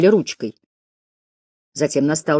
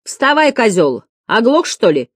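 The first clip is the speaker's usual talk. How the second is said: higher, impatient jeer; pupils' pitched-up nagging call; shouting classmates; 6 semitones higher than usual. A narrator's reading. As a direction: heckle a slow classmate. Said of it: "закричали несколько голосов".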